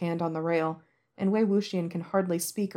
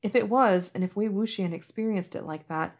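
ordinary delivery

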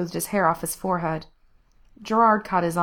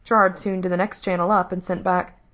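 plain reading voice

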